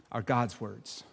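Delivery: normal talking voice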